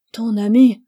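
loud, incredulous indignation; indignant challenge. A narrator's reading. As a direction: throw it back at him, outraged. Mila indignantly challenges Utugamise. (indignation vive, à voix forte)

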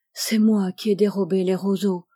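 ordinary delivery

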